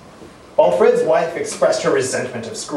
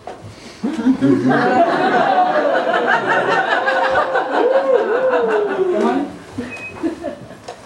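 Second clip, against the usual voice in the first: high unearthly cackling laugh; eerie high laugh. A narrator's reading. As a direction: let out a ghostly, high-pitched laugh. Ghost reveals children, eerie laugh.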